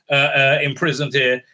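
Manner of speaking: ordinary talk, nothing marked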